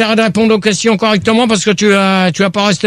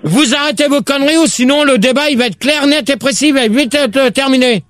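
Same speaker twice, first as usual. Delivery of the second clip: higher, worked-up voice; exasperated scolding; 6 semitones higher than usual. Real, not acted. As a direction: lay down the law, pitched high. Host threatens to end debate. (réprimande exaspérée, voix montée)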